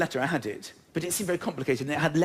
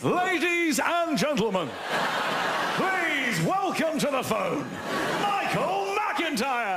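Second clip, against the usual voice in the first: showbiz announcer; 7 semitones higher, much slower than usual. Pretend. Agent imagined grandly announcing the comedian on the phone as a star. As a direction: announce him like a star entering the stage.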